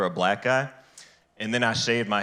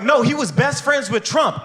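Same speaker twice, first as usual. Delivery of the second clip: higher, louder exasperated friend; 13 semitones higher, 5 dB louder than usual. Retold or imitated. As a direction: insist like an impatient friend.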